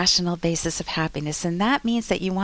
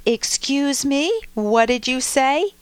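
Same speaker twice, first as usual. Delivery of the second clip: indignant retort; 5 semitones higher, 4 dB louder, slower than usual. Retold or imitated. Stepmom confronting stepdaughter.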